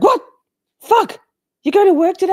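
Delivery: high, loud squeaky dog voice; voicing the dogs. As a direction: squeal it like a startled puppy. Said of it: "My dogs were like".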